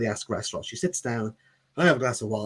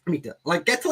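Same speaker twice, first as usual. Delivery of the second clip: ordinary delivery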